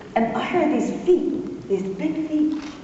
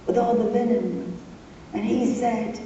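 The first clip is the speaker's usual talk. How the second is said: normal talking voice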